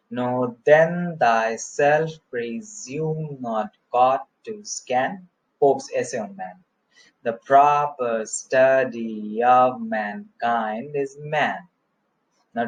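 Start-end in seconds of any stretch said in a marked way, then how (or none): 0.1-5.3 slow, measured beat, leaning long on "then", "presume", "God"; rhythmic verse recital. Shown as a demonstration. Teacher repeating the pattern with Pope's line, slow rhythmic scansion. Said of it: "I will try to make it rhythmic". 7.2-11.6 slow iambic beat with drawn-out stresses on "proper", "study", "mankind"; rhythmic verse recital. Shown as a demonstration. Teacher reciting Pope's Essay on Man, slow rhythmic scansion. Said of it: "I will try to make it rhythmic".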